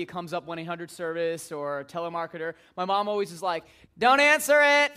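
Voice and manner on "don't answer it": loud, high yell from downstairs; mom calling out. Retold or imitated. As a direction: holler it up from the basement.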